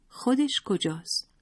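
a hint of a child's voice, drawn out; lightly voiced child. A narrator's reading. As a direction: ask it like a puzzled small child, lightly acted. Narrator voices the child asking.

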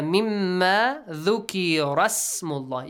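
slow recitation linking "dhukira" straight into "smullah"; dropped hamzatul wasl. Shown as a demonstration. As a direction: run "dhukira smullah" together with no glottal start. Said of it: "You ignore the hamzatul wasl".

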